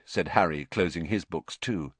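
usual speaking voice

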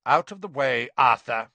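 loud, curt order; icy official command; cold, curt. A narrator's reading. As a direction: order him aside coldly. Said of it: "said a cold, curt voice".

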